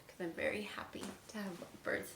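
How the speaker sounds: fast unvoiced whisper; excited whisper. Real, not acted. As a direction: whisper your glee. Playful whispered aside.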